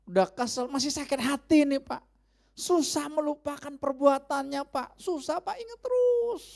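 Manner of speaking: a member complaining to the pastor, high and whiny; complaining churchgoer. Pretend. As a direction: whine about the grudge you can't forget. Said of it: "voicing a resentful congregant".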